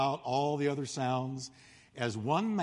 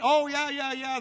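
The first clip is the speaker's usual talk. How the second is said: eager crowd; 9 semitones higher, 4 dB louder than usual. Retold or imitated. Crowd eagerly answers Blondin's question.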